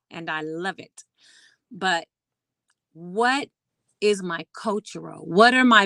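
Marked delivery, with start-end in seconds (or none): none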